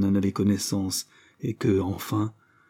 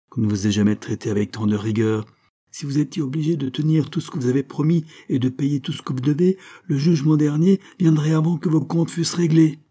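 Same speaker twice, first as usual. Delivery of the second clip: sour retort; 6 semitones higher, 4 dB louder than usual. A narrator's reading. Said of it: "riposta aigrement Marie".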